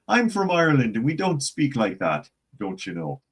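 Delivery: high, lilting stage Irish; mock Irish accent. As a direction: play an offended Irishman in a fake brogue. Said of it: "That's the American Irish".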